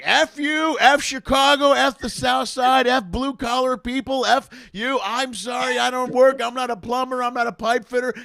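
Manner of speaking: high, loud imitated rant, partly slow; snapping at the crowd. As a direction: rail at the crowd like a bombing comic losing it. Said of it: "the guy snaps".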